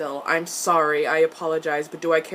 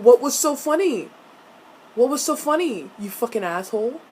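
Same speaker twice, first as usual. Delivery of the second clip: indignant demand; 3 semitones higher, 5 dB louder than usual. Pretend. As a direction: demand an answer in comic outrage. Mock-outraged rant at Jace.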